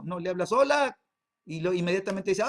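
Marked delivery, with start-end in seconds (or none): 0.5-1.0 bright, high and loud "Hola" greeting; cheery phone hello. Retold or imitated. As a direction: call out hello like picking up the phone. Husband greets wife on phone.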